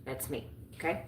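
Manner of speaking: usual speaking voice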